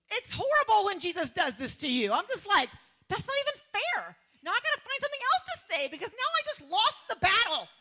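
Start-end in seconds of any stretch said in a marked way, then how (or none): 3.1-7.7 high, loud exasperated whine; hammed-up indignation; indignant protest. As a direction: protest a lost argument, half laughing. Acted protest after losing an argument.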